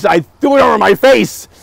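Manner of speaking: usual speaking voice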